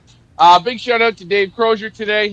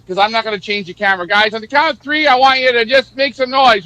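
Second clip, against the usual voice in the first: ordinary talk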